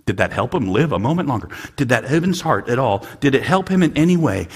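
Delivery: usual speaking voice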